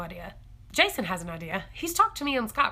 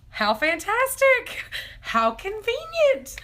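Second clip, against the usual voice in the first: high, slow, savoring delight; 12 semitones higher, slower than usual. Real, not acted. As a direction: stretch each word out with glee. Delighted at perfect timing.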